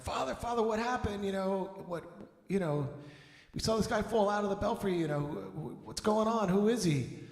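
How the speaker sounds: high, urgent questions from a worried onlooker; alarmed crowd. Acted. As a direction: pitch it up high and anxious, asking the priest what happened. Townsfolk asking what happened.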